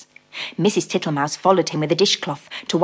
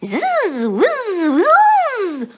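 high, peevish buzzing squeak; 3 dB louder, much slower than usual. Acted. Babbitty Bumble answers Mrs Tittlemouse in a peevish squeak. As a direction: squeak it out crossly like a bee. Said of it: "in a peevish squeak".

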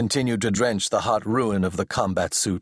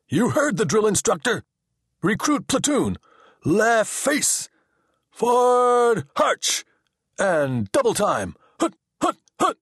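drill sergeant shout; 14 semitones higher than usual. A narrator's reading. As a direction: bark the cadence at recruits. Assistant drills recruits.

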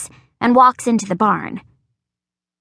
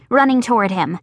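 ordinary delivery, nothing marked

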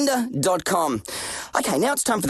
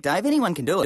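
usual speaking voice

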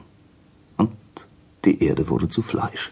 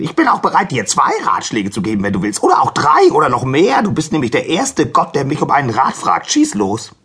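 very high, excited donkey voice; shouting donkey; 8 semitones higher, 4 dB louder than usual. Acted. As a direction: gush loudly with eagerness. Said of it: "schrie der Esel".

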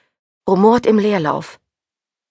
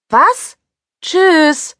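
high, loud goodbye call; calling a loud bye; 10 semitones higher, 6 dB louder than usual. A narrator's reading. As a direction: shout it cheerfully across. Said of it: "rief ich".

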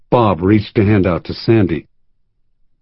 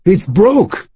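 put-on high, gleeful old man; delighted old man; 9 semitones higher than usual. Old man at the bar inspecting the nose, high gleeful. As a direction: relish the bad news, pitched up. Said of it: "his voice high with delight".

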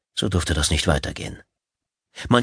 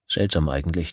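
ordinary delivery

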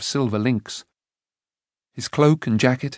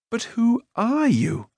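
higher, slow questioning; puzzled Taran; 11 semitones higher, slower than usual. A narrator's reading. Taran puzzled by the stranger. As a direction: ask in confusion.

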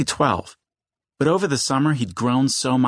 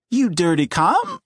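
voice cracking high; 7 semitones higher than usual. A narrator's reading. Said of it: "Eddie's voice cracked so high".

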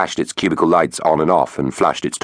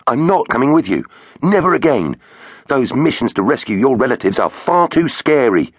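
Finn protesting; 8 semitones higher than usual. Acted. Finn refusing, high voice.

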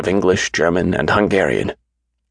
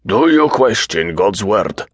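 slow, higher-pitched challenge; demanding; 4 semitones higher, slower than usual. A narrator's reading. Deluded king testing the doctor's faith, slowly demanding. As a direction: press each word as a demand. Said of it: "he demanded".

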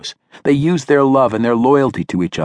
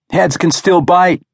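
raised, high, louder warning; called-out warning; 9 semitones higher, 5 dB louder than usual. A narrator's reading. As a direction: shout a warning across the fight. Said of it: "Victor called out".

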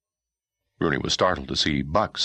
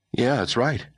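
put-on young boy voice; 9 semitones higher than usual. Boy character voice.

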